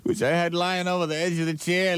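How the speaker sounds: ordinary talk, nothing marked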